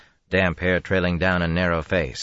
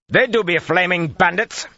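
much higher, loud fearful yell; shouting; 6 dB louder than usual. Acted. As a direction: call out accusing. Hidden man keeps shouting.

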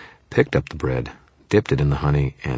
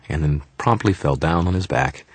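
ordinary delivery, nothing marked

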